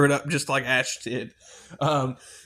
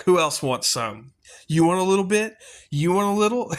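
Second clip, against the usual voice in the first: rising, cocky taunt that climbs higher toward the end; taunting the Deadites like a swaggering hero, pitched up; cocky Ash taunt; 6 semitones higher, 3 dB louder than usual. Retold or imitated. Fan quoting Ash taunting the Deadites, rising cocky voice. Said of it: "he's like".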